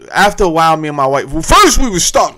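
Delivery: normal talking voice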